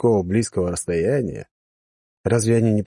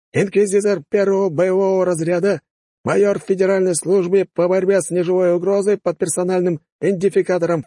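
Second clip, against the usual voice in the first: high, loud formal summons; booming announcement; 10 semitones higher, 3 dB louder than usual. Acted. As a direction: boom it across the parade. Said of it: "хорошо поставленным зычным голосом объявил".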